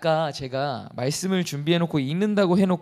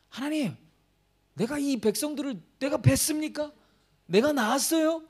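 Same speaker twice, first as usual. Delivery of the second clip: high whiny mock complaint to God; whiny complainer; 8 semitones higher than usual. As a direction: whine at God like a grumbling believer. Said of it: "권사님 맨날 원망하고 불평해도". (하나님께 투덜대는 높은 징징거림)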